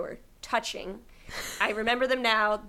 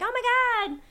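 much higher, gushing and fast; imagined excited kids; 12 semitones higher, 3 dB louder than usual. Retold or imitated. Girl imagining classmates thrilled about her VHS. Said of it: "people being like".

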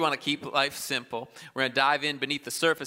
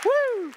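high, loud cheering whoop; woo; 6 dB louder than usual. Real, not acted. Preacher cheers the room on, high whooping. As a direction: let out a high celebratory woo.

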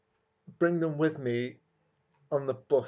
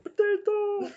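high cry, much louder; shouted safe word; 4 dB louder, slower than usual. Pretend. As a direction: yelp it out in panic.

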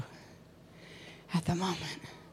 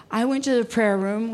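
usual speaking voice

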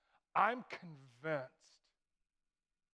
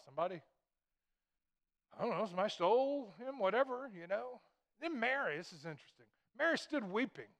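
usual speaking voice

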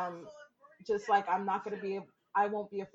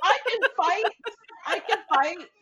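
laughing boast; 10 semitones higher, 8 dB louder than usual. Real, not acted.

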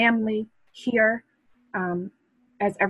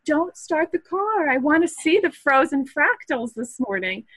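high-pitched playful yell at husband; mock yelling; 7 semitones higher, 4 dB louder than usual. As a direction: call out urgently, drawing out the first words, hammed-up. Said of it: "here I am yelling at my husband".

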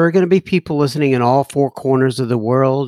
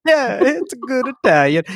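put-on higher, hammy Italian accent; mock Italian accent, pitched up; bad Italian accent; 8 semitones higher than usual. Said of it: "my bad Italian".

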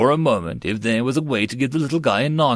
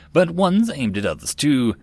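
usual speaking voice

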